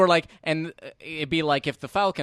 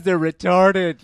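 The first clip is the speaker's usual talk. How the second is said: mocking cruel audience member, higher and louder; callous jeering voice; 6 semitones higher, 8 dB louder, much slower than usual. Voicing viewers who laugh at bad filmmakers, jeering and loud. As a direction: sneer it like someone enjoying others' misery. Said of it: "There's a level of being like".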